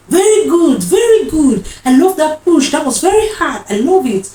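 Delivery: high, loud cheering on; excited praise. Retold or imitated. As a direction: imitate a midwife cheering a mother's push. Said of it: "'cause she was like".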